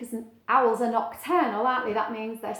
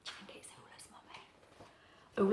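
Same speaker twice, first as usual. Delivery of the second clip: whisper; 4 semitones lower, 15 dB quieter than usual. Real, not acted. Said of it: "whispered question to child".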